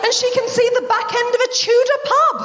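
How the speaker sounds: high incredulous rant. Acted. Comic questioning the doctor's word, high incredulous. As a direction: squeal it in disbelief. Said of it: "outraged comic riff".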